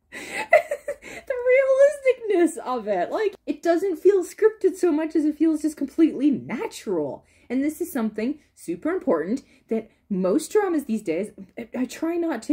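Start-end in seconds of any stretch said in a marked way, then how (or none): 0.1-7.2 laughing, higher and slow. Real, not acted. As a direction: speak through the tail of a laugh. Laughing while praising.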